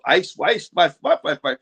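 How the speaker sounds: quick gibberish imitation of German; imitating German speech with nonsense sounds; mock German. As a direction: rattle off fake German syllables. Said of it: "he starts talking to me in German".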